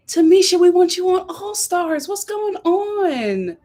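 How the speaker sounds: high wailing appeal to a favourite contestant; high, drawn-out fan's plea; high-pitched plea. Acted. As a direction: plead up high, stretching out the last words. Reacting to gossip about Tamisha.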